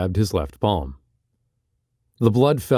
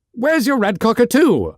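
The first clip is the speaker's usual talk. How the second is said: much higher, louder repeat; squealing insistence; 14 semitones higher, 4 dB louder than usual. Acted. Oberon presses Barker again, squealing high. Same question repeated, escalating.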